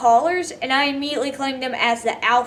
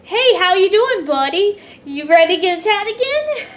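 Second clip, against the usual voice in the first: higher, louder teasing greeting for the wolves; mock wolf taunt; 7 semitones higher, 3 dB louder than usual. Wolves greeting the scared friend, mocking friendly taunt. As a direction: voice the wolves as cheeky bullies.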